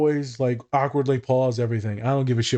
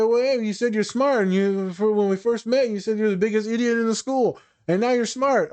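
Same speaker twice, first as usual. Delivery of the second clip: high, triumphant ribbing; gleeful teasing; 10 semitones higher than usual. Retold or imitated. Therapist teasing a client about calling themselves smart, high and gleeful. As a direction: rub it in playfully.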